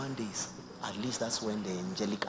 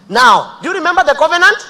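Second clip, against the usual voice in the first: much higher and louder, booming; preacher roaring a challenge; shouted question; 22 dB louder than usual. Acted. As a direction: demand it of the crowd.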